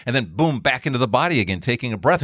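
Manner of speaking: usual speaking voice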